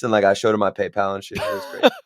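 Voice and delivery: ordinary delivery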